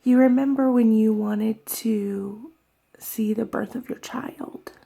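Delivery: faint, whispered last words; tearful whispered quote of the girl; dying girl's whisper. Retold or imitated. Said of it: "And she says to her".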